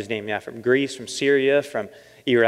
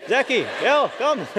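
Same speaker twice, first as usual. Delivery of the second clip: much higher, calling out across the street; taxi driver calling; 11 semitones higher than usual. Retold or imitated. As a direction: call him over, laughing. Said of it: "he's like".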